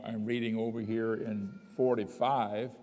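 usual speaking voice

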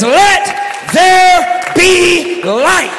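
very slow, commanding proclamation; voice of God. Acted. Preacher voicing God creating light, slow and booming. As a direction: stretch out each word like a decree. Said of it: "He says".

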